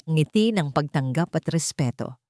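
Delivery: ordinary delivery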